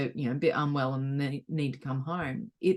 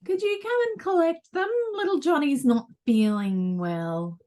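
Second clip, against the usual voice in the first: school receptionist voice; 9 semitones higher than usual. Retold or imitated. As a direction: voice the school calling. School office caller voiced.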